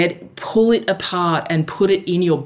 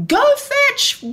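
yelling. Shown as a demonstration. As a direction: shout it across the park. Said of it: "and yell".

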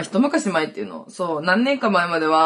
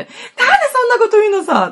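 much higher, louder crying protest; tearful wail; 6 dB louder, much faster than usual. Retold or imitated. Crying friend wails at Lisa, high and loud. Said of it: "友達がボロボロ泣き始めて".

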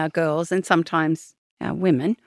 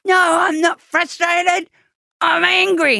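put-on angry kid shouting; 12 semitones higher, slower than usual. Dad imitating an upset daughter's angry shout. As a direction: yell it back like a furious little girl. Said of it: "And they're gonna go".

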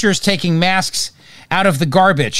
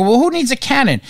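higher, scoffing "who needs a cannon?"; a mocking imitation of gun-control advocates, higher; mocking dismissive quote; 8 semitones higher than usual. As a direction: mimic a smug opponent. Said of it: "they'll turn around and go".

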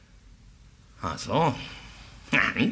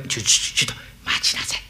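hushed urgent whisper; 7 semitones lower, faster than usual. Acted. Servant stops master in hushed haste.

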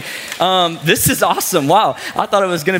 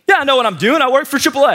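imitated irritated, higher-pitched comeback; frustrated retort; 7 semitones higher, faster than usual. Repairman to the doubting customer, mock frustrated. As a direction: act out a touchy, defensive repairman. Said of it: "he's pretty frustrated".

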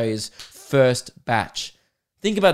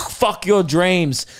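drawn-out, higher action-hero taunt; slowly crush someone with the line; Vin Diesel; 5 semitones higher, 4 dB louder, much slower than usual. Retold or imitated. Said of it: "Vin Diesel just goes".

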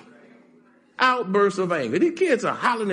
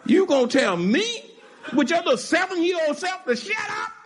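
indignant shout; 9 semitones higher than usual. Real, not acted. Indignant at a rude child.

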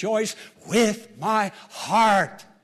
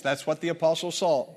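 usual speaking voice

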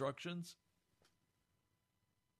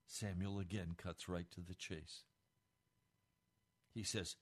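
ordinary talk, nothing marked

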